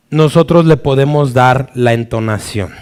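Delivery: ordinary talk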